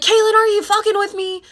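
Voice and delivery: high, loud accusing mock yell; mom's accusing yell. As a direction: play the shocked, accusing mom. Mom confronting her, retold.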